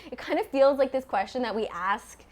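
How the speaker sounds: normal talking voice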